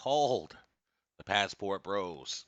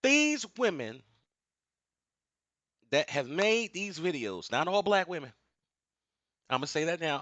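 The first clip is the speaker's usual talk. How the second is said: preaching rant; 8 semitones higher than usual. Real, not acted.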